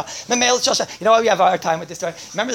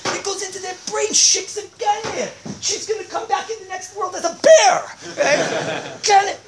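loud, high ranting denunciation; mock outrage; 8 semitones higher, 4 dB louder than usual. Storyteller exposes Goldilocks to the audience, mock outrage. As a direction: rail against the culprit, dragging out the insults. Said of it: "I don't usually get so upset".

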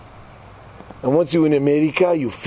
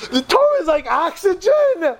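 high, slow, drawn-out pronouncement; lofty rebbe; 14 semitones higher than usual. Retold or imitated. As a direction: proclaim it like a grand teacher. Rebbe restates the point.

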